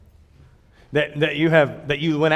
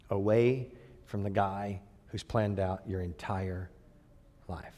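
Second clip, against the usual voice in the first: slow solemn; 4 semitones lower, slower than usual. Acted.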